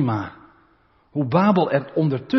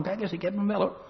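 gloating Satan; 6 semitones higher, 7 dB quieter, faster than usual. Retold or imitated. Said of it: "zo zit te lachen van".

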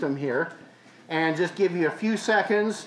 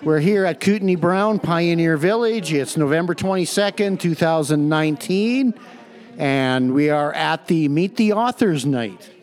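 loud, formal intro voice; announcer voice; 7 dB louder than usual. Acted. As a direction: announce the event clearly.

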